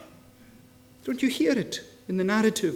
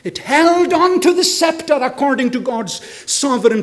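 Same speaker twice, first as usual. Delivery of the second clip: loud, ringing sermon delivery; full-force preaching; 6 semitones higher, 8 dB louder than usual. Acted. Preacher asks the congregation why Jesus came, preaching at full force. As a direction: preach it out to the whole hall.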